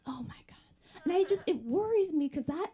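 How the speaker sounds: ordinary talk, nothing marked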